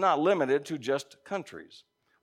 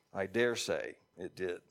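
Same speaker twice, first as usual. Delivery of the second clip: usual speaking voice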